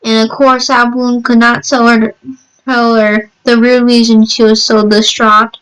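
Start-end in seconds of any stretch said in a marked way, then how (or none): none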